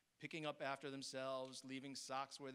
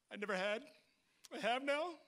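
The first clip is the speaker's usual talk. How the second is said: tearful; 7 semitones higher, 8 dB louder than usual. Real, not acted. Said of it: "bear with me if I cry".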